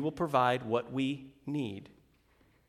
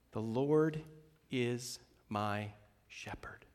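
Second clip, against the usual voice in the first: hushed quote; 3 semitones lower, 9 dB quieter, much slower than usual. Acted. Quoting psalm, hushed.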